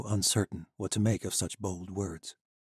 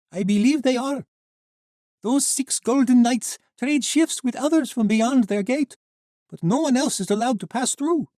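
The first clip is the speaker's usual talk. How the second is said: put-on high, light young woman; 7 dB louder than usual. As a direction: voice her bright and girlish. Sheva explains the knights.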